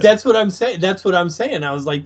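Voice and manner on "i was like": normal talking voice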